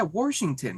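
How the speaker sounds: "Washington" said as "Worshington"; grandma's added R. Retold or imitated. Confirming to friend how grandma said it, imitated added R. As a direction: slip an R into "Washington", before the sh. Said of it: "she'd even say Washington".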